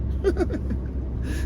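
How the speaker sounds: breathy high nervous laugh. Real, not acted. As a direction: laugh it off in a rush of air. Laughing at his own stress.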